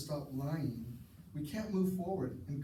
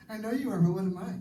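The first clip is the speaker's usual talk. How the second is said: put-on higher, louder childish retort; playground taunt; 5 semitones higher, 6 dB louder, faster than usual. Kids arguing on the playground, high sing-song taunt. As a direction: sing it back like a bratty kid. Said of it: "We learn how to argue as kids".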